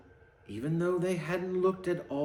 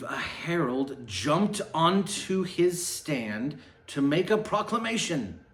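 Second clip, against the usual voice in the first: plain reading voice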